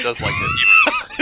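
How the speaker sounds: shouting. Real, not acted. Teasing shout about a friend.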